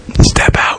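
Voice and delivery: unvoiced whisper of stepping out; whispered escape. Plotting escape at midnight, whispered. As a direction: breathe it like sneaking past guards.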